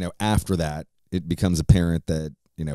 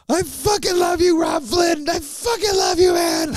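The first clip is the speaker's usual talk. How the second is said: high, loud mock wail of affection; imitated drunken crying; 4 dB louder than usual. Drunk bandmate saying goodbye to his friend, mock tearful high wail. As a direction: bawl it out like an emotional drunk, voice way up. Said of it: "he just starts crying and he's like".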